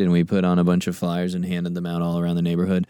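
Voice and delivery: ordinary delivery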